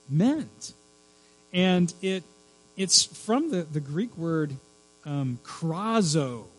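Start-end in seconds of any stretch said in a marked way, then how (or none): none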